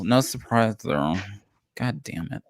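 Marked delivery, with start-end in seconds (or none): 1.8-2.4 muttered curse. Real, not acted. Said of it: "You sound upset".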